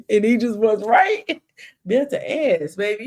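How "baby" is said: ordinary talk, nothing marked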